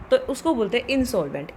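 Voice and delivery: ordinary delivery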